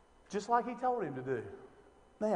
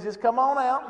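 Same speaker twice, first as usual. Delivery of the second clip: much louder and higher call; 7 semitones higher, 11 dB louder than usual. Acted. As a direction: urge the dead man out, loudly. Jesus calling Lazarus out.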